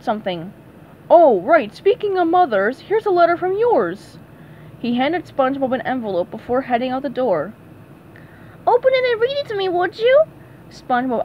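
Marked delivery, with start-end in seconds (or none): 1.1-4.2 louder, higher remembering; lightly voiced producer catching himself; sudden recall. Sam turns back to hand SpongeBob a letter, abruptly. 8.7-10.2 fast, much higher cartoon sponge; squeaky quick request; eager SpongeBob voice. SpongeBob asks Patrick to read the letter, high and fast.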